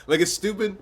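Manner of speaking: high, loud, cracking up; laughing. Real, not acted. Vlogger mocking Gen Z slang, laughing. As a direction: burst out through real laughter.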